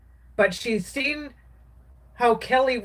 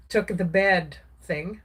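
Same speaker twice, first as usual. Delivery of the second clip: ordinary talk, nothing marked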